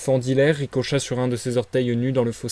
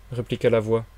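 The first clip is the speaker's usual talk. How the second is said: usual speaking voice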